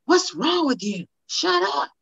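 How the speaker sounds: high, sharp scolding parent; high-pitched mock scolding of a crying child; impatient parent. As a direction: mimic a stressed parent snapping at a toddler. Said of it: "you're like".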